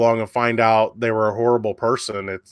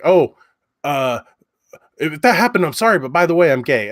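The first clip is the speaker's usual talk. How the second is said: mock defensive excuse; 4 semitones higher, 3 dB louder than usual. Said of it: "and he was like".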